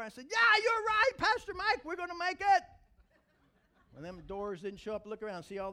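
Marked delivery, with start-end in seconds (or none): 0.3-2.6 high, loud joyful agreement; loud, high cry of agreement; excited shout. Retold or imitated. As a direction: shout yes back with faith.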